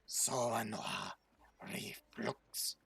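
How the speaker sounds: "So no reflux" low and throaty; guttural Draconic. Shown as a demonstration. Game master teaching Draconic, "So no reflux" low and guttural. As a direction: growl "So no reflux" from the throat, ending unvoiced. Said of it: "get into the throat".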